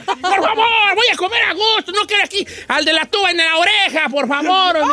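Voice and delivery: high-pitched furious shouting; high angry yelling. Pretend. Don Cheto yelling at band.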